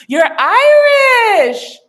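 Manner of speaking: loud, high, drawn-out squeal of delight; excited shout. Real, not acted. Streamer learning a viewer is Irish, thrilled shout. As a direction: shout it, stretching the words in amazement.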